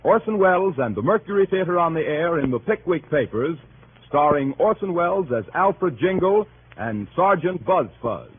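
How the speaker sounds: ordinary talk, nothing marked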